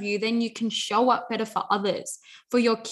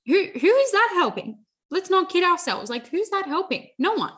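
indignant rhetorical question; 8 semitones higher than usual. Real, not acted. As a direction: demand it like it's absurd. Indignant rhetorical challenge to herself.

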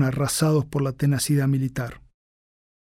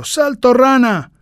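high, loud, slow barked order; harsh barking commands; 10 semitones higher, 9 dB louder, slower than usual. Acted. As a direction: bark the drill command. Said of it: "grita el sargento".

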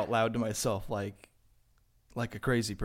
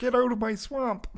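high, louder froggy voice; Kermit-like Shrek; 12 semitones higher, 4 dB louder than usual. Pretend. Friend joins the bit, squeaky Shrek as Kermit. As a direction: bark Shrek's line as Kermit. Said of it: "trying to do Shrek".

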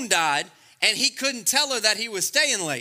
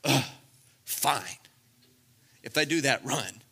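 grudging; 7 semitones lower, 3 dB quieter than usual. Retold or imitated. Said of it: "in other words".

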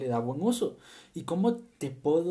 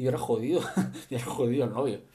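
laughing; 3 dB louder, much faster than usual. Real, not acted. Laughing joke about spoiling wedding.

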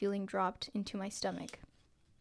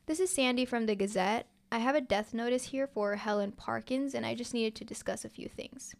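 usual speaking voice

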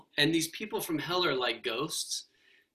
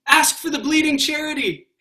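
much higher, louder plea; urgent plea; 11 semitones higher, 10 dB louder than usual. Acted. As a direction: beg him to ask. Solid person urges the ghost.

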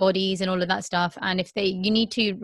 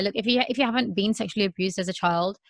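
usual speaking voice

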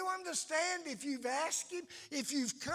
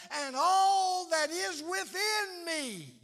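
loud, higher, slow cry of praise; fervent cry; 4 semitones higher, 6 dB louder, slower than usual. Pretend. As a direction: pour everything within into the cry. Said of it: "notice he says".

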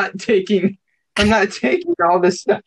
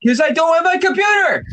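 exasperated shout; 9 semitones higher than usual. Real, not acted. Frustrated reply about missing computer.

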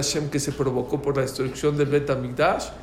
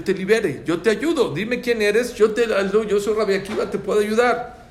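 raised, insistent rabbi's plea; urgent offer; 7 semitones higher, 5 dB louder than usual. Acted. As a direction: build to a high, loud offer of rescue. Rabbi urgently offers the man help.

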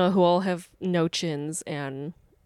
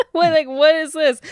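baffled; 9 semitones higher, 5 dB louder than usual. Retold or imitated. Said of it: "I was like".

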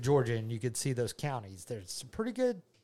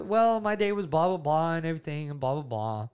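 starts high and loud, dwindles on "blah, blah, blah"; answer dying out; 4 semitones higher, 5 dB louder than usual. Shown as a demonstration. Dad demonstrates a conversational lull, answer dying away on "blah, blah, blah". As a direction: let "blah, blah, blah" sink away to nothing. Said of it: "just really kinda dies right there".

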